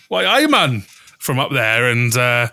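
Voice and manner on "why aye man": hammed-up Geordie accent, pitched up; mock Geordie greeting, thick and loud; bad Geordie accent. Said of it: "it's a really bad Geordie accent".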